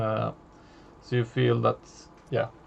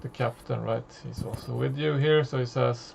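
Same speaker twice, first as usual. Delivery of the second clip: usual speaking voice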